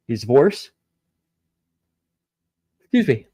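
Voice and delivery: ordinary talk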